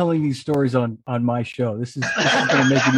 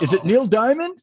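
mocking; 7 semitones higher than usual. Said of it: "quoting the mocking line".

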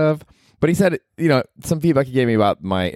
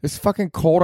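usual speaking voice

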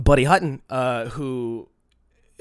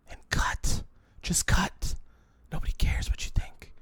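whispered stern instruction; hushed, blunt coaching whisper; 3 semitones higher, 7 dB quieter than usual. Retold or imitated. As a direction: hiss it under breath like a mentor. Quoting Buddy, whispered.

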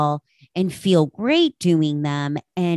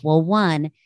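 ordinary talk

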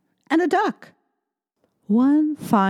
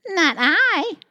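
high, louder cat voice; purring cat voice; 11 semitones higher, 4 dB louder than usual. Acted. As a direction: purr it like a cat. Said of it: "purred the cat".

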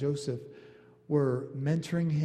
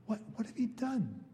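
bewildered mother; 9 semitones higher, 5 dB quieter than usual. Retold or imitated. Mary voiced in disbelief.

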